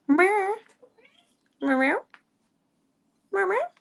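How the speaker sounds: high cat meow. Pretend. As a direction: mew playfully at the cat. Meowing at the cat.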